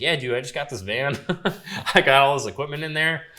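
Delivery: proud, laughing announcement, voice lifted; retold eager yes. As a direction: reenact jumping at the offer. Retold eager yes to job offer.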